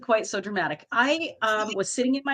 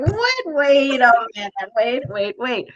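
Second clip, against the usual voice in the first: indignant; 4 semitones higher, 5 dB louder, slower than usual. Retold or imitated. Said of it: "I'm like".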